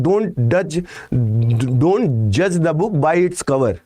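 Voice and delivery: drawn out word by word, very slow; slow quoted proverb. Retold or imitated. Teacher quoting a proverb to students, very slow and deliberate. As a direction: lay the proverb out slowly so it sinks in. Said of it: "वो बोलते हैं ना".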